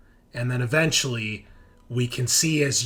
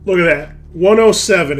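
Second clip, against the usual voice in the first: loud, higher, triumphant at finding the page; excited find; 6 semitones higher, 13 dB louder than usual. Real, not acted. Game master finding the rule for the players, excited. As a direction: call it out like you just struck gold.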